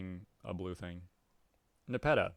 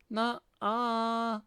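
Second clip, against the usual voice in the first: put-on much higher, childish defiant cat girl; 13 semitones higher, 5 dB louder than usual. Cat girl refusing the troll's order, high and childish. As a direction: squeak back like a defiant kitten.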